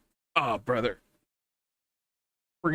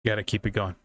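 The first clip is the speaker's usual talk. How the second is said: usual speaking voice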